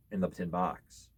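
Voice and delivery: ordinary delivery, nothing marked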